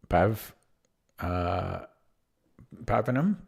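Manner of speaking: cracking, failing voice. Real, not acted. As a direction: voice cracking on a rising question. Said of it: "Not sure what happened to my voice".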